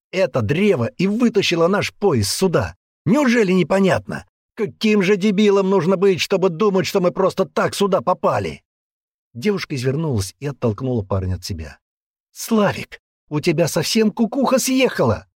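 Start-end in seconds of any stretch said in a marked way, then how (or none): 0.1-8.6 high, insistent, heated argument; louder, pressing outburst of disbelief; exasperated man. Acted. Man convincing the girl about the tree, heated exasperation. 12.3-15.3 high-pitched exasperated shout; girl's shout. Acted. Girl to the raving man, high exasperated shout. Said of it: "закричала она".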